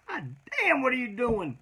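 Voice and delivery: mock outrage at the friend pressing the wound; yelling at a friend who hurt him; retold pained yell. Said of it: "It's like".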